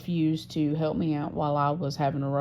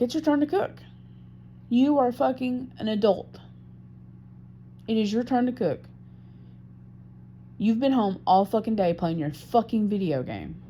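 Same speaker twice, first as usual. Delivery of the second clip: higher-pitched annoyed telling-off; exasperated retort; 6 semitones higher than usual. Retold or imitated. Speaker retelling how they told partner to cook, exasperated. Retold quarrel over cooking supper.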